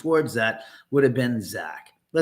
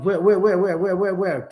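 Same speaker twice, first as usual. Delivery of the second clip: high, rapid, eager repeated calls; searching eagerly, calling out over and over; bouncing, eager where-where-where; excited calling; 6 semitones higher, 3 dB louder than usual. Real, not acted. Excited hunt for a chat user.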